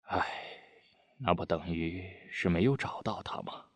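soft, sighing letdown; deflated. A narrator's reading. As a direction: let the energy drain out, voice dropping. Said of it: "德武泄了气". (泄气地轻声叹息)

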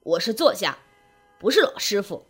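low, put-out correction; displeased old writer. A narrator's reading. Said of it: "忽地拉长了枣核脸".

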